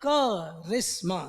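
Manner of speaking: करिश्मा drawn out slowly as क, then Rishma; slow breakdown of Karishma with a long first क; syllable by syllable. Shown as a demonstration. Teacher breaking the word up for students, syllable by syllable.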